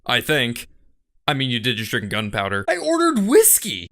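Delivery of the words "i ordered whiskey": indignant protest. Acted. Protesting drinker.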